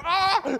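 sudden high startled "Ah!"; scared yelp. Retold or imitated. Scared kid in story.

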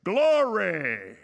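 loud, drawn-out shout of GLORY; mimicked church shout, long falling tail; imitated shout. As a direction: belt it out like a congregant interjecting. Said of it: "shout out with this deep African voice".

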